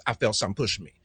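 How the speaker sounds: low, soft murmur to himself; quiet aside. Real, not acted. Preacher pausing to murmur what he felt, quietly. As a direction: drop the voice into a private aside.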